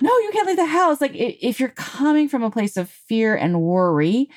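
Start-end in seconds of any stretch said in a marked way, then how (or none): 0.0-1.0 high-pitched mock-panicked refusal; panicked parent. Fearful parent refusing the kids' outing, mock panic. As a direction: act an alarmed parent forbidding it.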